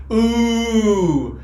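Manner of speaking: long, loud impressed "ooh". Real, not acted. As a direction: draw out a loud ooh in reaction. Reacts to a cutting article.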